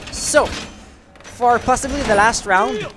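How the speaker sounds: high, loud, slow opening of the round; announcer round intro. Acted. Caster opening the English round for chat, announcing loudly. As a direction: announce the map like an arena host.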